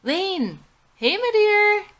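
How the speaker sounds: high, loud gushing hello; light up at your best friend's call; delighted greeting. Acted. Madison answering her best friend Lane on the phone, thrilled.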